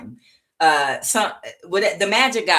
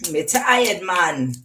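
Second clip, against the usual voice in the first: put-on weary Jamaican accent, a little higher at the start; tired Jamaican; 3 semitones higher, 4 dB louder, slower than usual. Reader voicing the tired Jamaican, weary Jamaican accent. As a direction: play a fed-up Jamaican accent. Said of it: "this Jamaican is tired".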